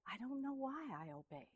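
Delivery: whispered, wondering quote of mother; mother's hushed words. Retold or imitated. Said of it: "she says".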